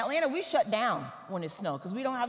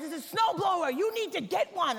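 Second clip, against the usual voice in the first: exasperated neighbor; 7 semitones higher than usual. Retold or imitated. Neighbor's exasperated answer, retold.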